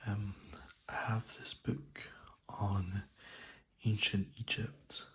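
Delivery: soft ASMR whisper; soothing spa whisper. Real, not acted. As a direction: whisper softly and close to the mic. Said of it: "I'm gonna do this in a soft spoken voice, as this is an A S M R video".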